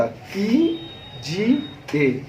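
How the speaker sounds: की जी ए spelled out softly; syllable demo. Shown as a demonstration. Teacher repeating की जी ए for the class. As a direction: separate की, जी, ए. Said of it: "ध्यान से सुनिएगा".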